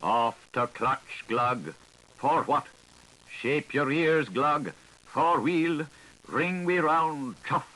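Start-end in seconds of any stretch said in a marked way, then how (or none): none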